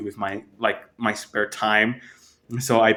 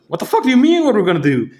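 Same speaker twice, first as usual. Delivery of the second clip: high, louder mock outrage; incredulous outburst; 9 semitones higher, 4 dB louder, much faster than usual. Narrator retelling his reply to the clerk, high incredulous outburst. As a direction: fire it back in disbelief.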